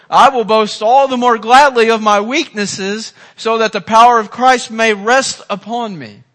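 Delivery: preacher reading Paul's boast to the congregation, loud; loud preaching. Acted.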